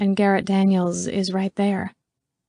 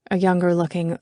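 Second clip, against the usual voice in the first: plain reading voice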